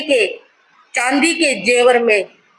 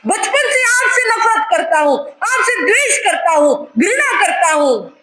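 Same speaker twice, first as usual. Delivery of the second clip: higher, shouting with bitter laughs; hateful tirade; 6 semitones higher than usual. Acted. Reborn son denouncing his father, bitter shouting. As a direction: spit out the hatred.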